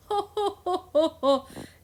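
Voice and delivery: high, drawn-out laugh; string of open ha-has at your own pun; laughing at own joke. Real, not acted. Teacher finishes a corny rose pun, high laugh.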